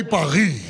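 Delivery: "Paris" said paɹi, the s dropped; "Paris" the French way, final s silent. Shown as a demonstration. Telling ambassadors how to say "Paris", French with no s. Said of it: "You must say".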